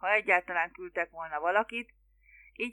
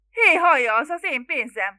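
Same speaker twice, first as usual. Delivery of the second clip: loud, high protest; indignant outburst; 6 semitones higher, 11 dB louder than usual. A narrator's reading. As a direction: burst out in outrage. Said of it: "fakadt ki Megtevis". (felháborodott, hangos kifakadás)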